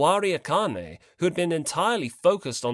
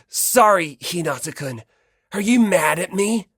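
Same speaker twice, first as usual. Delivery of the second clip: insincere apology; 3 semitones higher, 7 dB louder than usual. Acted. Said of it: "Komaeda's apology rang insincere".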